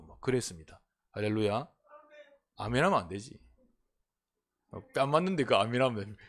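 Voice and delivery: ordinary talk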